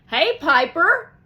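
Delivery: high, loud call across the bus. Acted. Jerome calling from the back of the bus, loud. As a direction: hail her from the back seat.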